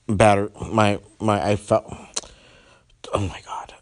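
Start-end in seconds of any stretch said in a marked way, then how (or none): 3.1-3.7 whispered under breath on discovering the cut; whispered oh-my-God of dismay; real whisper. Real, not acted. Podcast just cut out.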